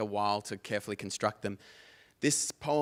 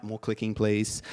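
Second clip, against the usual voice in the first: normal talking voice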